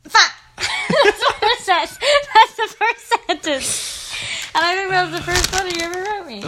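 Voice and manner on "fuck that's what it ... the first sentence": laughing. Real, not acted. Laughs reading old letter.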